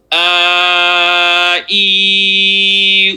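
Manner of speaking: A, I, U each held long and steady; stretched vowels. Shown as a demonstration. Teacher stretching vowels for two counts. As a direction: stretch A, I and U out from the stomach. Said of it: "Would you do it once with me".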